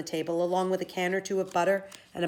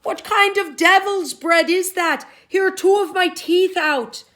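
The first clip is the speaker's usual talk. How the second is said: furious giant shout; 12 semitones higher, 12 dB louder than usual. Acted. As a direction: roar in pain and anger. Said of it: "shouted Cuchulainn".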